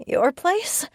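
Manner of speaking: high startled crack. A narrator's reading. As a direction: voice breaks upward on "place". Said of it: "My voice cracked".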